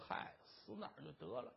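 有气无力地低声嘟囔; 绝望地小声自语; 心里念叨. Acted. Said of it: "自个儿心说". (低声自言自语)

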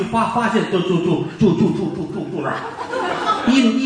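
stammering badly, repeating first syllables; a nervous stutterer, tripping over every word; stuttering. Stutterer reporting to the magistrate, stammering throughout. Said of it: "知道他结巴哈".

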